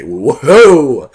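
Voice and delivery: mock excitement. Pretend tasting shampoo ice cream, loud hammed-up whoa. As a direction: blurt it like a thrilled tasting. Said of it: "and say".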